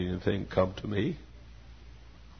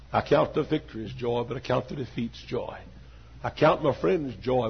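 normal talking voice

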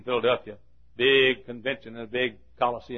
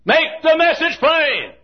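high, slow, drawn-out shout; preaching holler; 10 dB louder, slower than usual. Real, not acted. Preacher defending hard preaching to his church, full holler. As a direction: belt it out from the pulpit.

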